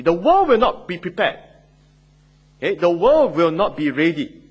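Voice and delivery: a firm preached warning that the world will not be ready; emphatic warning. Acted. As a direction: press the warning home to the congregation.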